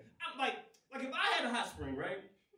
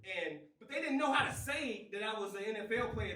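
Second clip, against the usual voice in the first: ordinary talk, nothing marked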